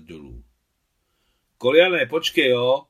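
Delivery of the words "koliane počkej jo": put-on high young boy's voice calling loudly from below; a boy shouting to his friend from the ruins. A narrator's reading. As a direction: call down like a gentle young boy. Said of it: "Rozlehl se dole jemný chlapecký hlas".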